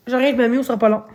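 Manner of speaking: normal talking voice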